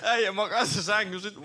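high, giggling retelling; high-pitched laughing story; laughing while telling. Retold or imitated. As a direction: squeak it out through laughter.

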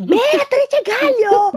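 slow, high, loud call. Retold or imitated. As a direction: stretch her name out loudly.

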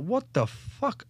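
high, slow drawn-out what the fuck; shocked disbelief. Retold or imitated. Corporals spotting the blood in the corridor, high incredulous drawn-out gasp. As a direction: corporals seeing blood everywhere, stretched in shock. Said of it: "they're like".

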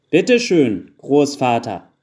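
loud presentation, high start then falling; calling out. A narrator's reading. Carpenter handing grandfather his stick, calling loudly. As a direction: call it out to an old man. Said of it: "rief Andersen".

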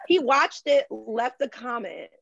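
normal talking voice

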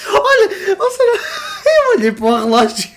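talking through real laughter; high, loud, breaking into laughs; laughing. Real, not acted. Cracking up at the time.

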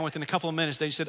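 usual speaking voice

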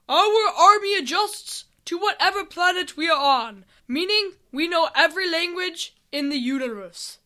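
loud, high-pitched proclamation; booming leader addressing newcomers; army leader. Acted. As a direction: proclaim it grandly like a commander. Army leader explains himself.

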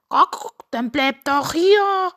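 loud, high cluck and plea; urging rooster. A narrator's reading. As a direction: blurt it as a cartoon rooster, stretching the end. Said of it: "sagte Henry schnell".

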